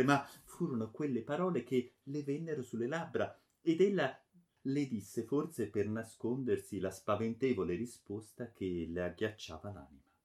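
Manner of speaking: usual speaking voice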